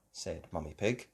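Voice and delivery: ordinary delivery, nothing marked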